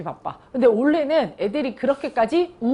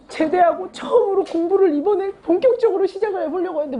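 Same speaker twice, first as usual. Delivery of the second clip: a higher, lightly tearful student voice; imitating tearful student; 8 semitones higher than usual. Student tells teacher he just left the army, mock crying. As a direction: mimic the student's crying, half-hearted. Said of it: "막 이러면서 막 우는 거예요".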